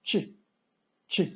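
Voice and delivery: chi with a light, soft CH; very soft, delicate chi. Shown as a demonstration. Teacher introducing a frightening-looking letter, soft delicate chi. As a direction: make the CH in chi delicate and short. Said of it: "It's a very soft, very delicate chi".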